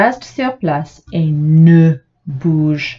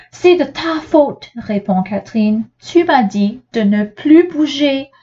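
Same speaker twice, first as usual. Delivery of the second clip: put-on child's voice; 8 semitones higher than usual. A narrator's reading. Child answers her father.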